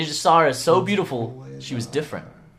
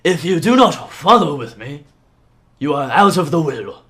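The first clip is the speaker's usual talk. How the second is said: loud, commanding father; forceful in-character order; stern father; 2 semitones higher, 8 dB louder than usual. Acted. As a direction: lay down the law, loud and firm. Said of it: "he says".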